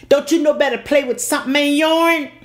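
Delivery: high, loud scolding father; daddy scolding. Retold or imitated. Angry father scolding his daughter for playing in church, high and loud.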